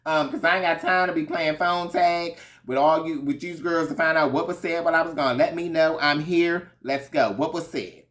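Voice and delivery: loud, high confrontational demand; imitating a ready-for-war Karen. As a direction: challenge the whole table to speak up. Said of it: "She was ready for the girl".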